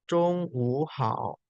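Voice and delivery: "Cung wu hao" said slowly, first word in tone two; slow teacher's tone model; slow tone demo. Shown as a demonstration. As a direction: spell out each tone of "Cung wu hao". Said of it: "Nada tiga ketemu nada tiga, jadinya ini nada dua".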